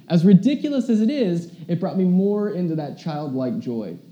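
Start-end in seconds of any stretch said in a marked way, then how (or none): none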